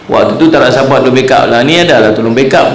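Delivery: ordinary talk, nothing marked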